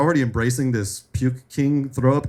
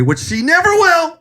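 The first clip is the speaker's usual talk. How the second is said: playful denial; 5 dB louder than usual. Real, not acted. Denies cat will ever die.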